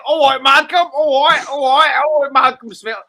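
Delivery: loud, high, hammed-up hard man; boisterous mock tough-guy shout; hard-man greeting. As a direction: bellow a repeated greeting like a comic hard man. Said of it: "he's just acting a hard man".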